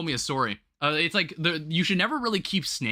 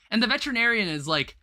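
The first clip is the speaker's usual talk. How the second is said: usual speaking voice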